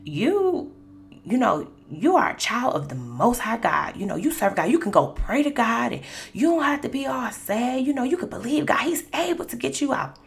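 lightly imitating mom setting her straight; mild rebuke. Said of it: "kinda rebuked me".

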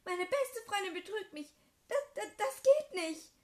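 high, loud, slowing into a wail; betrayed girl shrieking, near tears; hurt screaming. Acted. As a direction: stammer it out as you break down. Betrayed friend breaking down.

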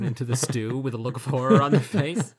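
narrating a bullywug's horror at the stew. Retold or imitated. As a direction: build to a horrified pitch. Horrified bullywug in tavern scene.

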